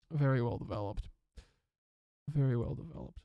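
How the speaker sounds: a touch of mock-British praise; mock-posh aside. Shown as a demonstration.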